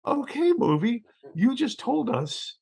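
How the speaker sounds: high, slow, amazed drawl. Retold or imitated. Reacting to the film's twist, high amazed voice. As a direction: marvel at the movie. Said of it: "I just went".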